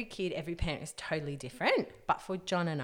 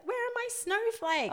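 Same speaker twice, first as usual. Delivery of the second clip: put-on much higher child's wail; upset toddler. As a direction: whine like a small child. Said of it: "he'll wake up and be like".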